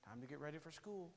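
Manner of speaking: soft quick mock dad wake-up call; quietly voicing Dad at the door, hurried. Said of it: "he would say something like this".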